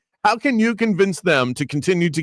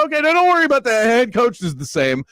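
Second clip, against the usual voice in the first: high, fast, salesy reassurance; mock coach pitch; 8 semitones higher than usual. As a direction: sell it like a hyped-up spokesman. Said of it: "One way to get them excited is go".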